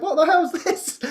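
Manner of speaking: high, laughing disbelief; retold bafflement. Gardeners facing plants they never planted, high amused bafflement. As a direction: ask it amazed, near a giggle. Retells amazement at plants growing.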